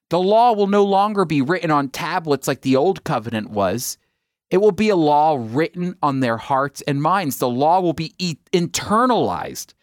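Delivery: normal talking voice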